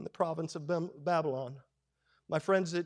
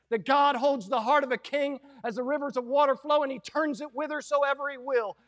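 ordinary talk, nothing marked

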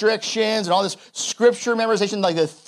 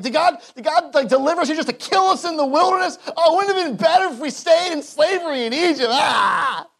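whining complaint; 8 semitones higher than usual. Retold or imitated. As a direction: wail it like hungry, fed-up people. Israelites complain in the wilderness.

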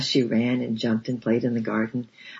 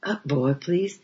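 coaxing; 6 semitones higher, slower than usual. A narrator's reading. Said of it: "again in a coaxing voice".

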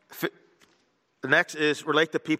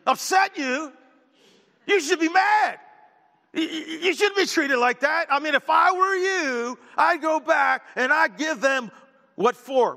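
higher, louder mock outrage that eggs you on; hammed-up hothead friend; 12 semitones higher, 3 dB louder, slower than usual. Friend egging on a coworker's grudge, hammed-up and loud. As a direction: stir them up like an angry friend. Voicing a hot-tempered friend.

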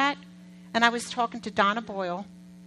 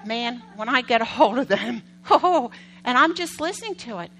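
usual speaking voice

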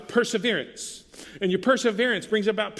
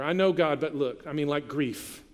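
normal talking voice

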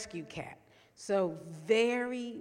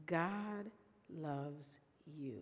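tender declaration; 4 semitones lower, 9 dB quieter, much slower than usual. Real, not acted.

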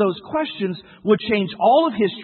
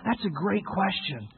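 soft, slow, accepting reply; gentle reassurance; 7 dB quieter, slower than usual. Retold or imitated. Father answers his doubting son, gently reassuring. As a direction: welcome it quietly, taking your time.

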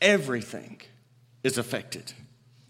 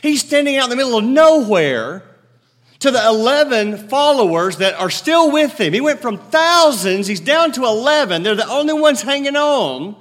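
loud, high preacher's call; preaching at full force; 6 semitones higher, 6 dB louder than usual. Acted. Preacher to his congregation, loud and ringing. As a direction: proclaim it to the whole room.

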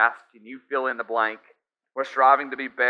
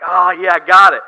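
loud, high burst of triumph; excited shout; 6 semitones higher, 13 dB louder than usual. Retold or imitated. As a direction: shout it like you just nailed the lift. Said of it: "I'm like".

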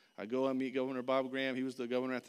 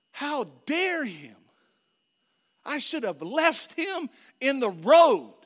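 slow, loud, high-pitched outrage; acted-out indignant shouting; angry; 12 semitones higher, 14 dB louder, slower than usual. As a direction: hit each word hard, reliving the fury. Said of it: "I got so angry".

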